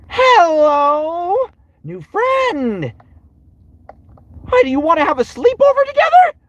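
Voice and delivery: very high, loud, manic cartoon voice; Sun. Streamer greeting a new friend as Sun, high and manic. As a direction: squeal an over-eager invitation at top pitch. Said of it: "I can do Sun".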